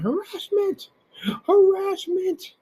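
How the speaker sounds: high, drawn-out mocking whine of harassment; a hammed-up squeal of harassment; mock falsetto wail. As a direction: mimic him wailing it, way up high. Mocking Fernando's cry.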